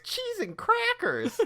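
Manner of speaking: very high, slow squeaky cat voice; cartoon cat cry. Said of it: "the cat goes".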